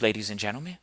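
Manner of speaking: ordinary talk, nothing marked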